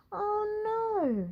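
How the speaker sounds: high shocked gasp. Acted. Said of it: "he gasped".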